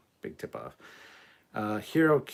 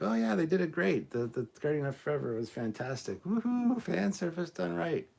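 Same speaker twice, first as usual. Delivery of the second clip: mock cheerful fake enthusiasm, higher; sarcastic praise; 7 semitones higher than usual. Mocking his own forced praise of a bad episode, sarcastic cheer. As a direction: gush sarcastically about a show he disliked. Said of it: "and be like".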